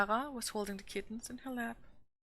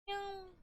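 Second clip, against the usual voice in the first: tiny high kitten mew, soft; kitten meow; 12 semitones higher, 7 dB quieter than usual. A narrator's reading. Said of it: "sounded as the apparent answer".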